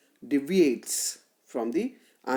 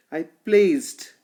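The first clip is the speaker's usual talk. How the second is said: "placed" hit louder and falling after a light "I"; stress on placed; 6 dB louder than usual. Shown as a demonstration. Lecturer shows students the stressed syllable, punching "placed". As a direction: punch "placed", keep "I" short. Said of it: "placed is stressed".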